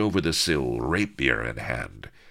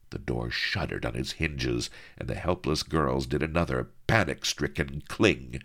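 usual speaking voice

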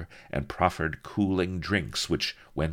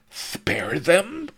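shocked; 6 dB louder than usual. Acted. Viceroy aghast at the idea.

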